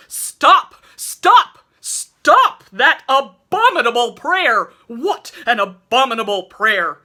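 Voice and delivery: roaring at the top of his lungs, high and loud; furious booming shout; thunderous shout. A narrator's reading. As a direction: bellow it to stop the prayer. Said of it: "shouted in tones of thunder".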